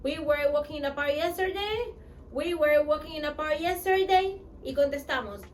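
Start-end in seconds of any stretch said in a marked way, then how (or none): 0.0-4.4 "We were walking in the park yesterday" said twice with a lilting tune that stresses "yesterday"; with intonation. Shown as a demonstration. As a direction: give the sentence a little melody. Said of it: "dar un poquito de entonación". (con un poco de entonación)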